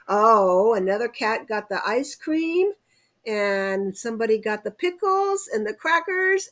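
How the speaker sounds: ordinary delivery, nothing marked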